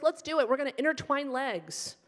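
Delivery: high, peppy trainer voice; bubbly, high-pitched gym enthusiasm. Retold or imitated. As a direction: pump up a new workout partner.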